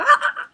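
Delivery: very high, loud squeal; fangirl scream. Retold or imitated. Fan bursting over people she really likes, squealing. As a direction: let out a piercing starstruck squeal.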